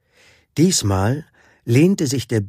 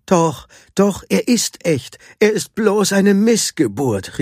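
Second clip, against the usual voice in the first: high-pitched, emphatic protest; insistent calling out; 9 semitones higher than usual. A narrator's reading. Medici contradicting the doubting audience, insistent call. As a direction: call it out high to convince a crowd. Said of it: "rief Medici".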